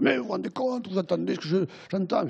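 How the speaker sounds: indignant husband. Retold or imitated. Husband angry at revelation.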